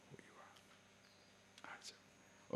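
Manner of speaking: breathy whisper, almost no voice. Acted. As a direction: lean in and whisper it as a secret. Teacher dropping to a hush.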